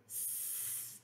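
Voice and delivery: a long voiceless "s-" like a snake; hissed s sound. Shown as a demonstration. Teacher sounding out the final s. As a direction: hiss the "s-" with no voice. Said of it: "that's the sound I hear at the end".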